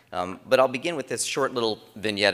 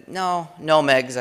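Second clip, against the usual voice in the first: higher, louder no no, correcting; quick loud correction; 10 semitones higher, 9 dB louder, much slower than usual. Retold or imitated. Dad retelling how he corrected his daughter, loud. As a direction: cut her off with a firm no.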